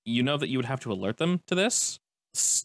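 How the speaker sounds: normal talking voice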